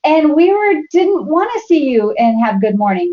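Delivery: playful retelling of mom's habits; son mimicking mom. Son teasing mom at family dinner, playful. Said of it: "And he goes".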